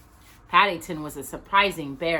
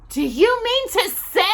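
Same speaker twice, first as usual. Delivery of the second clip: high, louder outraged question; indignant disbelief; 5 dB louder than usual. A narrator's reading. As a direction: sputter in shock. Indignant at the theatre price.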